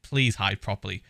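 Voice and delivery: soft, low, regretful plea; quietly beg, as if remembering the guilt; retold guilty pleading. Vlogger recalling his plea to Tommy to take cover, guilty pleading. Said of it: "I was just sat there like".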